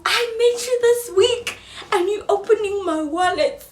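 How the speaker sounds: high, laughing through the words; outraged laugh over the wallet; laughing outrage. Real, not acted. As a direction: laugh out the disbelief.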